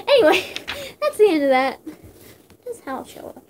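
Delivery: usual speaking voice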